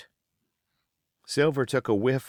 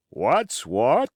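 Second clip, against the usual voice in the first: deep growl; 8 semitones higher, 6 dB louder than usual. A narrator's reading. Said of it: "in a deep growl".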